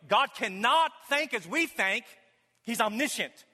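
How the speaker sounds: high, loud, fervent pulpit preaching; full-force preaching. Acted. Pastor to his congregation, preaching at full force. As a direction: drive each point home to the congregation.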